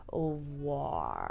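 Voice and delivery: "war" drawn out in a mock accent; put-on French accent. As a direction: stretch out "war" as a shaky attempt at a foreign word. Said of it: "I can put on the accent".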